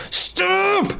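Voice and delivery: sudden high-pitched yell; angry yell. Retold or imitated. Dad yelling at his toddler who refuses the car seat, sudden high angry yell. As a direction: bark it out like a fed-up parent at the end of patience. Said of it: "Like, I was so mad".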